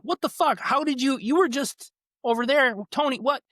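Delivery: mock freaked-out disbelief; freaking out. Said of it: "freaks out".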